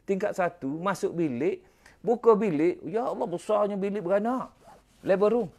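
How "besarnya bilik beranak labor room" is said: ordinary talk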